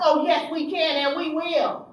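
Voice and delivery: loud, high, unbending; defiant anger. Retold or imitated. Counselor defying her supervisor, loud and unbending. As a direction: slam it down.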